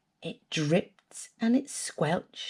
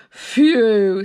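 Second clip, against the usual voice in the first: loud blown-out phew; relieved phew; 8 dB louder than usual. Acted. Dog after the danger, loud relieved phew. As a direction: sigh it out in relief. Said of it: "said the dog".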